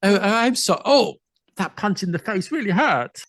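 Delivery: high-pitched posh accent, playing hurt; put-on posh. Londoner reels from a pub punch, put-on posh accent. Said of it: "I was putting on a bit, bit, bit more posh".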